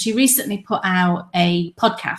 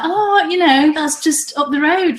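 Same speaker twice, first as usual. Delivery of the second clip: lightly voiced mum, higher and lively; quoting mum; 7 semitones higher, 4 dB louder than usual. Daughter quoting mum's reaction to a book, lightly voiced. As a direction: pitch it up as you quote your mum. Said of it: "she was like".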